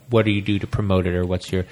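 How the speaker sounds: usual speaking voice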